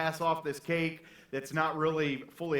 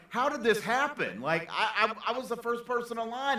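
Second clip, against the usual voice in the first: higher, half-acted panicked cry; mock panic; 6 semitones higher than usual. Baker finds her cake gone, higher mock panic. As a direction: play the baker's fake alarm, pitched up. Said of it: "freaking out".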